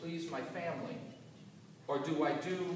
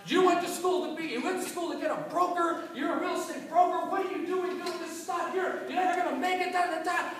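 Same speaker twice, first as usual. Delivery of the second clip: high, loud scolding dad; father cussing out his broke son, high and angry; 12 semitones higher, 4 dB louder than usual. Retold or imitated. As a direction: berate your son like a furious father. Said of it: "he started cussing me up and down".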